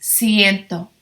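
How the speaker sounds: ciento said see-EN-tho, falling; clear ciento. Shown as a demonstration. Teacher repeats ciento for students to copy. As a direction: model ciento slowly. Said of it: "we say ciento".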